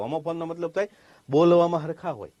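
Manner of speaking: ordinary talk, nothing marked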